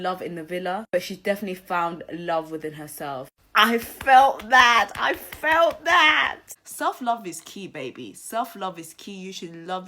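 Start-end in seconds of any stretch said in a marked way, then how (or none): 3.6-4.9 loud and slow, leaning on every word; emphatic loud. Real, not acted. Vlogger reacting to Liberty's episode, loud slow emphasis. 5.0-6.4 higher shouted and slow; excited shout. Real, not acted. Vlogger hyping her own reaction to viewers, high slow shout.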